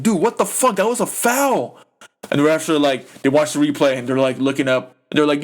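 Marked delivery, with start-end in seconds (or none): 0.0-1.8 high-pitched mock outrage; imitating frustrated players. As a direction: squeal it like a fed-up player. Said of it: "the players were frustrated, like".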